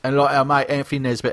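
ordinary talk, nothing marked

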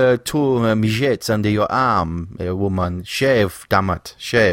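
hammy French nagging; French accent. Acted. Co-host orders French woman to shave, French accent. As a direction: scold in broken French-accented English. Mock French scolding continues.

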